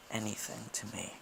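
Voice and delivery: soft unvoiced whisper. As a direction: breathe it confidentially. Confessing love to client.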